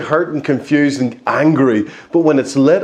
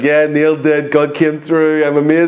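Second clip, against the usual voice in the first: playful gloating victory cheer; mock triumph. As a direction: cheer like a giddy dancer. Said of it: "like one of those crazy dancers".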